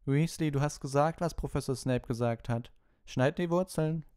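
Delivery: high, loud taunting order; gloating schoolboy. A narrator's reading. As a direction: lord it over a classmate in a high voice. Malfoy gloats, orders Ron around.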